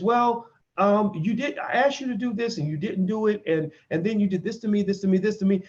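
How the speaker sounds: hesitant woman pointing out wrongs; voicing the woman. Woman listing what her partner did to her, lightly voiced. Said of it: "she says".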